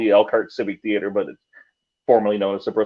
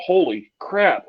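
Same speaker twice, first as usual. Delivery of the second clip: higher, slow amazed exclamation; retold astonishment; 8 semitones higher, much slower than usual. Reacting to hearing the audio, retold amazed exclamation. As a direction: let the shock stretch the words out. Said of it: "listening to the audio and going".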